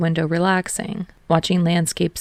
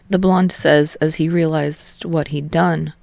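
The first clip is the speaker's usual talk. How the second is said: ordinary delivery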